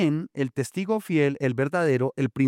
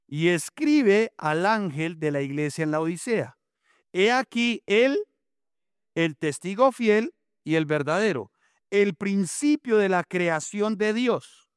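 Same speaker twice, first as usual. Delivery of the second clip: raised, declaiming scripture reading; Bible reading; 5 semitones higher than usual. Acted. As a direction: read the verse out like a preacher. Preacher reads Revelation aloud. (lectura bíblica declamada)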